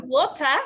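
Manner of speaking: "water" said loudly with clear T, no final R; emphatic British-style "water"; British pronunciation. Shown as a demonstration. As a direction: try "water" the British way, ending "tah". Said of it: "I can understand also British accent".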